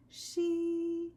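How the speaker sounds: she sung on a high so note. Shown as a demonstration. Teacher showing the high so note. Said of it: "One of them's a high note".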